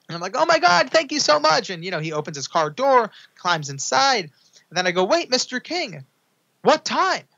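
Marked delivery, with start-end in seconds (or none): 0.4-1.7 very high, louder gushing thanks; thrilled fan gushing thanks; overjoyed thanks. Retold or imitated. 5.0-7.2 high, pressing call-out; eager question. Retold or imitated. Chasing celebrity for time.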